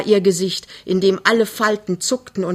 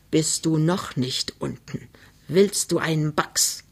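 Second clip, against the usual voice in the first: slow, softer, measured menace; slow threat; 4 dB quieter than usual. Acted. Mother threatens her dawdling daughter, slowly. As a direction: spell the threat out slowly and quietly. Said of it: "Sie sagte langsam".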